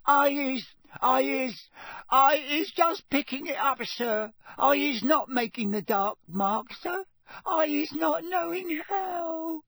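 very high, crying squeaky elf voice; tearful elf. A narrator's reading. Elf begging the official, crying and squeaking. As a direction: plead through tears. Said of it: "tears streaming down".

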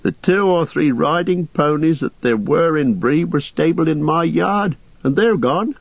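high, fretful innkeeper voice; unhappy landlord. Acted. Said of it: "said the landlord unhappily".

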